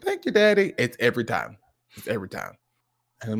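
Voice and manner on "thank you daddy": put-on high child voice; sweetly grateful kid, pitched up; sweet little son. Son thanking Daddy, sweet and high. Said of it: "he's so sweet".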